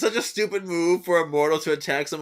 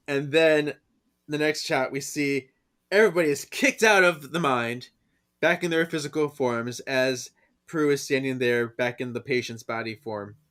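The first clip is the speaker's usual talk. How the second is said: ordinary talk, nothing marked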